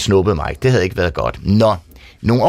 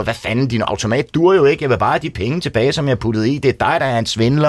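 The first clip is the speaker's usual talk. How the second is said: ordinary delivery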